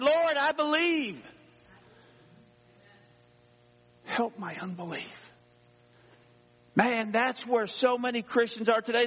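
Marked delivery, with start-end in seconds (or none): 0.0-5.3 beg, then let it fall away; desperate father's cry. A narrator's reading. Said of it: "the man cried out".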